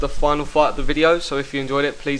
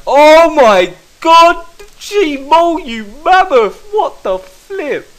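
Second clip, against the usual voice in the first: much higher, much louder, slow stretched scream of disbelief; shocked excited scream; 13 semitones higher, 11 dB louder, much slower than usual. Real, not acted. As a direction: shriek it out, floored by the hit. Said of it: "I wasn't expecting that, as you can tell".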